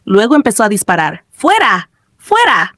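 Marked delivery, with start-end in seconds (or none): 1.3-2.7 higher-pitched sharp command; mock battle cry. A narrator's reading. Player shooting at monsters, mock battle cry. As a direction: order them out, voice raised in pitch.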